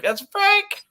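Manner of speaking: spring the joke on them, high and loud; telling friends at the meetup the knife is fake; gleeful reveal. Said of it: "And I'm like".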